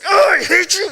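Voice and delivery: reenacted furious shout, high and very loud; angry yell. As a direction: yell it like a kid mid-fistfight. Acting out himself fighting his brother.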